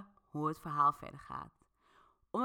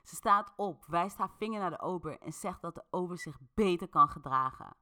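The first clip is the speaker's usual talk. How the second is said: plain reading voice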